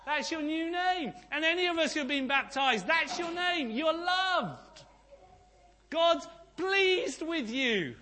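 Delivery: fiery sermon climax; preaching at full force. Real, not acted. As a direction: preach it out, declaring victory. Preaching climax on new name.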